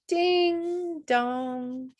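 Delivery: plain reading voice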